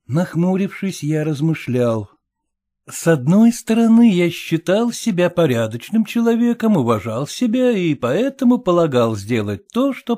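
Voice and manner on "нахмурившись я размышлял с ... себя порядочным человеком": usual speaking voice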